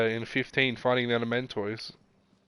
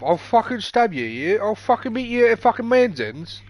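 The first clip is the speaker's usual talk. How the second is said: put-on loud, high rough English accent threat; 9 semitones higher, 5 dB louder than usual. Trash-talking the co-player in a loud mock English street accent. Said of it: "the accent I just did earlier".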